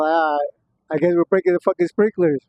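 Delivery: normal talking voice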